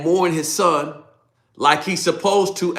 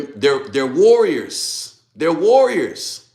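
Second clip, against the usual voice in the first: slow, louder stress on warriors; emphatic insistence; 2 semitones higher, 5 dB louder, much slower than usual. Retold or imitated. Joab pressing David about his warriors, slow loud stress. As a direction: lean hard on warriors, slowly.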